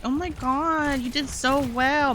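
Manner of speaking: a touch of sugary gushing, slow; mild mock praise. Mocking the guy praising the other girl, lightly sugary. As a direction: lightly coo the compliment.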